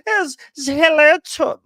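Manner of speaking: high, loud mock German accent; bad German accent. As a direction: greet someone in an exaggerated, badly faked German accent. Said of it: "trying to do the German accent".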